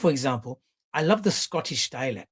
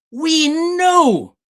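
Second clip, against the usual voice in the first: high, loud hammed-up declaration; mock proclamation; 13 semitones higher, 10 dB louder than usual. Streamer mocking the Muppets, high loud declaration. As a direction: announce it like a grand revelation.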